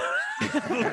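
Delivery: shrill evil cackle; high cackling laugh. Acted. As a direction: cackle gleefully mid-spell. Evil laugh while casting.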